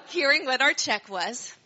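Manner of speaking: ordinary talk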